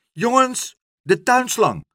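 put-on high, loud call to the boys. A narrator's reading. Father Stamper calls his sons to fetch the hose, loud shout. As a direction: shout for the garden hose, full voice. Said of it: "riep vader Stamper".

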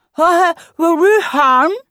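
high, slow, loud, through a mouthful of bun; mouth full. Acted. Jasper asking, his mouth still half full, high and slow. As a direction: talk round a big bite of bread. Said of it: "met zijn mond nog half vol".

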